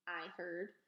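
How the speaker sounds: ordinary delivery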